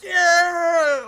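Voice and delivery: high, loud whooping yeah; rowdy cheer. Retold or imitated. The teens cheering the arrest threat, high and loud. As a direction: cheer like a reckless teenager. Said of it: "They're all like".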